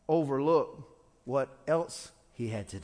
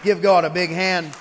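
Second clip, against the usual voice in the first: calling out; 3 semitones higher, 11 dB louder than usual. Real, not acted. Calling congregation to applaud.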